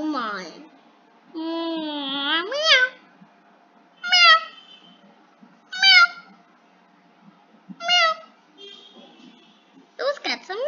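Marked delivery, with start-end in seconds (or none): 2.4-4.4 high kitten meows, a bit louder. Retold or imitated. Answering the mewing cats on screen with high meows. 7.8-8.1 high meow. Retold or imitated. Imitating the cats on screen.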